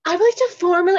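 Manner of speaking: high, loud influencer apology voice; James Charles impression. Second go at mocking an apology video, hammed-up influencer voice. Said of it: "James Charles, like, impression".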